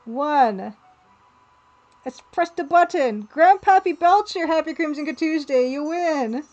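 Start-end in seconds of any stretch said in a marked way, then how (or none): 2.0-6.4 higher and louder, a big cheer for the winner; excited winner announcement. Real, not acted. Streamer announcing the giveaway winner to chat, excited and loud. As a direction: announce the winner like a game show host.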